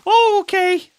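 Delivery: loud, high-pitched alarm; startled shout. Real, not acted. Streamer just scared in game, startled high shout.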